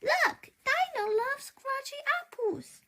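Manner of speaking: put-on very high, tiny girl's voice; little sister Poppy showing off her toy, very high. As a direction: squeak it out like a small girl. Little sister shows her dinosaur.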